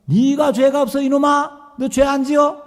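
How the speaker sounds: high, loud angry pastor; scolding shout. Retold or imitated. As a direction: bark it at the stubborn boy.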